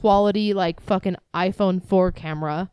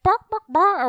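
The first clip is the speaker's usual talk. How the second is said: mock animal noise; 12 semitones higher, 3 dB louder than usual. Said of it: "the chicken noise people make".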